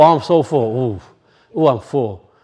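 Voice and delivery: low, quiet groan of a full belly; groaning stuffed. Retold or imitated. Overeater after second plate, groaning stuffed. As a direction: moan like you ate too much.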